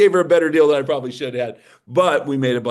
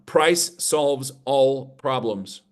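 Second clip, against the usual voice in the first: each word spaced out slowly so they can write; slow dictation; much slower than usual. Shown as a demonstration. Spell out the key rule slowly. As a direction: seminar speaker tells the room to write this down, slow dictation. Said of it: "And write this down".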